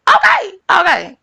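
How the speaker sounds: sudden very high, loud okay shout; defiant shout. Real, not acted. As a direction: yell it out to close the point. Defiant peak of her rant.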